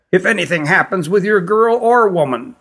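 high, reedy queen's voice; reedy-voiced queen. A narrator's reading. Queen sending visitors to rest, high and reedy. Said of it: "in her reedy voice".

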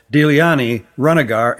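usual speaking voice

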